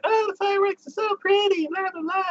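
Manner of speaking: high, breezy imitation of gushing passersby; high mock-gushing crowd voice; mock passerby voice. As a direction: toss it off high and airy like a gushing stranger. Passersby voiced in retold scene.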